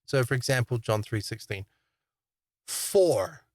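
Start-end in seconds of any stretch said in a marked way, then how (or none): 2.7-3.5 "For" drawn out long, then a pause; stress on For. Shown as a demonstration. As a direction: hit the word "For" and hold it. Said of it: "emphasize different words to uncover".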